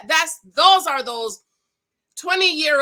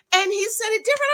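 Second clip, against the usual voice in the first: excited; 9 semitones higher, 4 dB louder, faster than usual. Retold or imitated. Husband rephrases the question.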